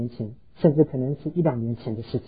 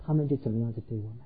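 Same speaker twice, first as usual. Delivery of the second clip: soft quick payoff; 4 semitones lower, 10 dB quieter, faster than usual. Acted. Preacher delivers the mirrored answer, soft and quick. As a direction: drop softer and faster to close the thought. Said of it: "sermon point, mirrored second half".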